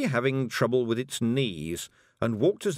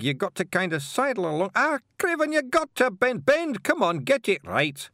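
high Scots voice, growing louder and exasperated; flustered Scottish ordering that builds to yelling; bossy Scottish stranger; 11 semitones higher, 4 dB louder than usual. Acted. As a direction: marshal an unruly crew in high, broad Scots. Stranger orders his limbs about.

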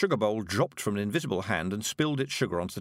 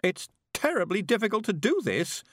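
moaning; 10 semitones higher than usual. A narrator's reading. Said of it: "Miss Level moaned".

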